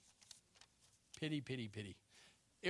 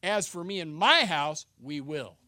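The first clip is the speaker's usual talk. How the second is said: authoritative; 3 semitones lower, 7 dB louder than usual. Shown as a demonstration. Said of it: "Authoritative. Here's how it is".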